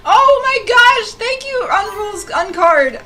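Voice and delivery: very high, loud and slow; gushing squeal. Real, not acted. Streamer thanking a raider, gushing squeal. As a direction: gush thanks at the top of the voice.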